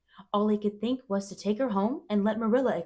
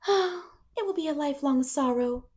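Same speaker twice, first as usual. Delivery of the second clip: sighing; 9 semitones higher, 4 dB louder than usual. A narrator's reading. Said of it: "the girl only sighed".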